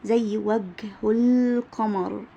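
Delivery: وجه القمر said slowly with the ه kept as ه; teacher demo of وجه القمر, ه kept; slow demo phrase. Shown as a demonstration. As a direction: draw out وجه القمر keeping the ه. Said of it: "بتنطق هاء زي ما هي".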